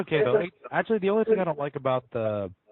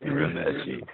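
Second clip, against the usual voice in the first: imitated anime call of a name; Kuwabara; slower than usual. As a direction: put on the character's voice for his catchphrase. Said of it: "I can only think of Kuwabara as".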